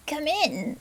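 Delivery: a witch squeaking a sly welcome; squeaky witch. Acted. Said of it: "squeaked the wicked voice".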